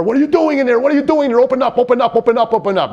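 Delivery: high, loud, furious demands to open up; man yelling at a locked bathroom door, furious; angry yelling through a door. Retold or imitated. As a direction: pound on the door and yell to be let in. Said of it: "And he's angry".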